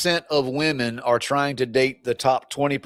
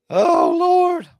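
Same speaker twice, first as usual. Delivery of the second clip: high exclaim; 3 dB louder than usual. Real, not acted. Reacting to the comment.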